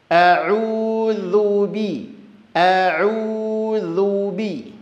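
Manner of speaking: very slow a'udzu bi with a clear i; clear kasrah i. Shown as a demonstration. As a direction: stretch a'udzu bi out syllable by syllable. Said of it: "maka bunyikan dia ke huruf i". (a'udzu bi sangat lambat, i jelas)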